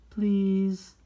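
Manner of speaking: high, loud sing-song please; bright modeled please. Shown as a demonstration. Teacher modeling please high and loud for children. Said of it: "please say".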